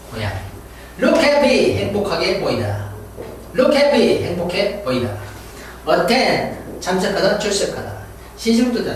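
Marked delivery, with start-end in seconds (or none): none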